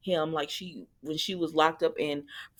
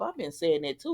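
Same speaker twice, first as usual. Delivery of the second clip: ordinary delivery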